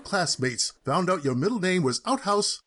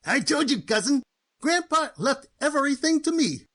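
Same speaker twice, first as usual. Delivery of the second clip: normal talking voice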